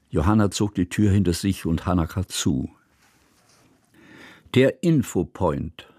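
usual speaking voice

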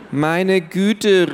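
put-on teenage brother losing patience with the family; exasperated exclamation. A narrator's reading. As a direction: burst out, out of patience. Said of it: "rief Max".

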